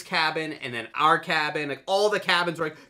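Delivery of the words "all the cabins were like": usual speaking voice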